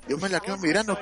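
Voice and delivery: ordinary delivery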